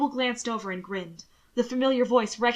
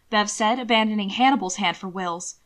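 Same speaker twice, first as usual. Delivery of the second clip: ordinary delivery, nothing marked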